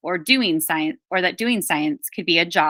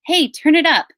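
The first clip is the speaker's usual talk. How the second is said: raised, higher call over the music; 8 semitones higher, 6 dB louder than usual. Acted. Boy in the workshop calling to turn the reggae up, loud call. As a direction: shout it up to a friend across the room.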